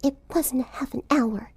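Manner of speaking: soft, slow, breathy grumble under the breath; timid girl grumbling at the rear; muttering. A narrator's reading. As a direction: mutter it to yourself.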